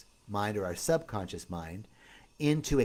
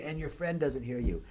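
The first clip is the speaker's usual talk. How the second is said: ordinary talk, nothing marked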